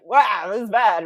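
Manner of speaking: a hammed-up loud lament; mock dismay. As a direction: blurt it out in exaggerated shock. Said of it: "He's like".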